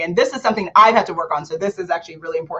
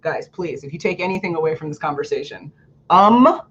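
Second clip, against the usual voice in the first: normal talking voice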